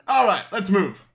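high, loud and happy; cheerful rallying call. Acted. Coin maker sets off very happily, loud cheerful call. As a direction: call out a hearty order to get going.

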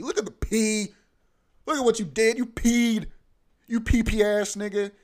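loud, high scolding of a pet; yelling at the cat. Retold or imitated. Angry owner yelling at the cat who peed on the floor. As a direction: rub its nose in it and yell.

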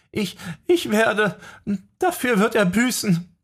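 stammer, then swear revenge; tearful vow. A narrator's reading. Jessica verweint, dann entschlossen. (unter Tränen stockend geschworen)

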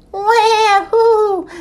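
high wail, then high hoot; seal calls. A narrator's reading. Seals together at last, calling. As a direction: call like pup and mother seal. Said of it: "said the seals".

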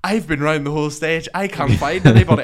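frustrated; exasperated complaint. Retold or imitated. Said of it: "Thomas comes walking".

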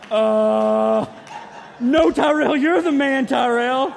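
loud, high, laughing denial; nervous laughing protest. Retold or imitated. To big Tyrell at the car window, loud laughing protest. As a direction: shout it out, half laughing in nervous disbelief. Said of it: "And I was like".

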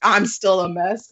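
normal talking voice

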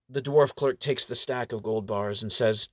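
ordinary delivery

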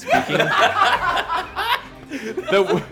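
incredulous question through laughter; real laughing disbelief, voice lifted; laughing teasing. Real, not acted. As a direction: tease him while cracking up. Host teasing player amid group laughter.

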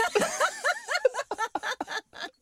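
real laugh breaking up high and loud; high squealing laugh. Real, not acted. Laughing at his co-host's secret being revealed, high squeal. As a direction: let a squeaky laugh burst out.

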